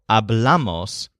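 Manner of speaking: hablamos slowly, with bla stressed; stress on second syllable. Shown as a demonstration. Teacher pointing out the exception, slow second-syllable stress on hablamos. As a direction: lean on the middle syllable of hablamos, falling after it. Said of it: "has the stress on the second syllable".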